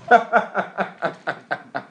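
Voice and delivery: amused laugh. Real, not acted. Laughs after reveal.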